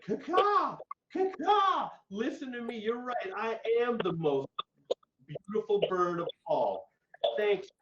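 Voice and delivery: squawking high crow caw, then a high birdy voice. Acted. Crow sings to the fox, squawking high. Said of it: "she opened her beak and went".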